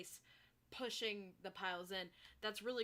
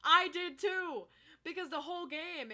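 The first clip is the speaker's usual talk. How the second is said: excited; 8 semitones higher, 9 dB louder than usual. Real, not acted. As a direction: blurt it out in delight. Excited agreement with the creator.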